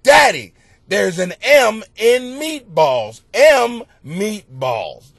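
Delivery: loud, slow exasperated retelling; exasperated dad. Retold or imitated. Father retelling his son's question, loud and exasperated. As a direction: spell it out fed up.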